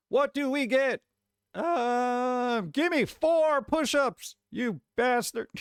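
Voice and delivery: goofy jock teacher doing arithmetic; mock gym teacher. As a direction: play a meathead gym teacher fumbling a math lesson. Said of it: "you want your gym teacher teaching math".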